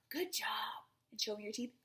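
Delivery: ordinary delivery, nothing marked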